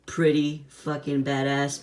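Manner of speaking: normal talking voice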